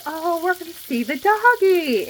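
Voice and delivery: high, sing-song mock of a sweet lady; lady cooing about the doggy; mocking sugary lady. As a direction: mimic her cooing at the dog.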